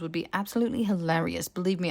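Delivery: usual speaking voice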